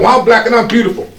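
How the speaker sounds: put-on high, loud childlike pride; a little boy answering the angry mother, high and proud; proud child. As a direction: declare it like a proud little kid. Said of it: "I was like".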